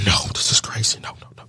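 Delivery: whispered, disbelieving self-talk; hushed denial. Retold or imitated. Man denies his feelings to himself, whispered disbelief. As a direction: whisper it to yourself, trailing away.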